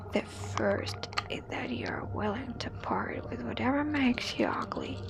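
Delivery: usual speaking voice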